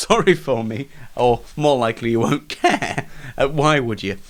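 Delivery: ordinary talk, nothing marked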